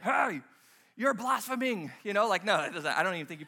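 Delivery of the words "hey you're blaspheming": louder, called-out mock accusation. Pastor imagining someone at Starbucks, mock accusing. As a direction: call it out like a public accusation.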